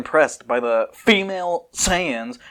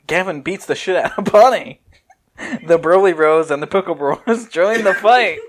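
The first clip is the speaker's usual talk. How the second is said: laughing; 6 semitones higher, 6 dB louder than usual. Real, not acted. Laughing while reading storyboard.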